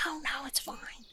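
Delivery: very high, soft whispered comfort; high soothing whisper. Acted. Chimney comforts his weeping lordship in a high whisper. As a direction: hush him like a crying child. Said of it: "He's like".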